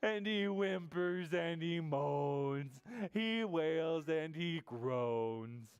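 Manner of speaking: slow, moaning storybook narration; mock whimpering. A narrator's reading. Storyteller on the sad bear, slow mock wailing. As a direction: drag the words out like sobs.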